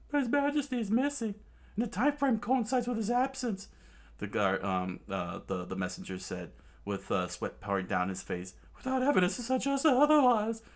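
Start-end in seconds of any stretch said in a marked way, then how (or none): 0.1-3.7 high, fast panicked messenger voice; frantic messenger. Acted. Messenger reporting the king missing, high and panicked. 8.8-10.6 high, fast panicked messenger voice; frantic messenger. Acted. Messenger reporting the king missing, high and panicked.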